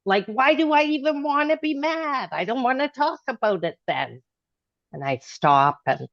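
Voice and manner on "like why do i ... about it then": pitched higher, exasperated inner voice; replaying her own frustration, voice up high; exasperated self-quote. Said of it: "And I'm like".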